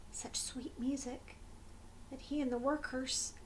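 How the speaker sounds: hushed storyteller whisper. A narrator's reading. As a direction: breathe the words like a secret. Magic music, suspense.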